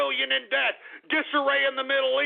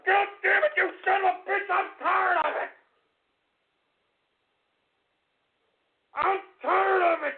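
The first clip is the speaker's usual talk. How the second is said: fed-up rant building to high screams; hysterical, anguished yelling; screaming outburst; 4 semitones higher than usual. Real, not acted. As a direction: scream it at the end of your rope.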